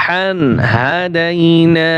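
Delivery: very slow, long held vowels; slow drawn recitation. Shown as a demonstration. Teacher joining the words for students, slow and drawn. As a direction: recite it stretched and careful. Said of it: "এক আলিফ টান হবে".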